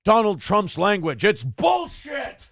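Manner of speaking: mock offended pundit, slowed right down; Joe Scarborough. Host mocks a TV anchor's outrage, sarcastic impression. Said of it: "gets to sit there".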